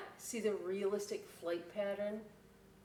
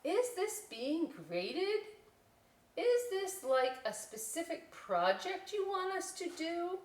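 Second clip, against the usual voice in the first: higher, slow worried questions; fussy student; 9 semitones higher, 3 dB louder, slower than usual. Acted. Student bat asking Miss C about grading, higher voice. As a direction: pester the teacher for rules.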